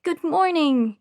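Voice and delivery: cheerful nurse's chirped hello; chirpy. A narrator's reading. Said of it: "Cassie chirped".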